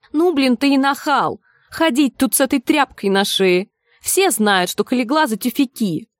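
put-on needling, mouthy young fan; cocky street youth. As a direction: trade slangy football jibes with a rival. Youth taunting a rival in dialogue.

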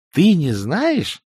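high, drawn-out disbelieving question; slowly, in a high boy voice; incredulous youngster. A narrator's reading. Boy asks the clueless wizard, incredulous.

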